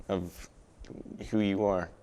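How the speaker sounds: normal talking voice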